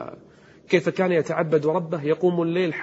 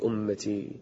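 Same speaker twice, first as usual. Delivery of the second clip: fading plea; 6 semitones lower, 8 dB quieter, slower than usual. Acted. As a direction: drop to a soft, pleading fall. Prophet's prayer voiced in prostration. (أمتي بصوت خافت متوسل)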